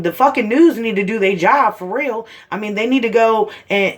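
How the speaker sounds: normal talking voice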